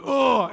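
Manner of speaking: high exasperated "Ugh!" again; frustrated sigh. Retold or imitated. Pastor repeating his ditch groan, high exasperated sigh. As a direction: groan it out in annoyance. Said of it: "sigh, you know, like".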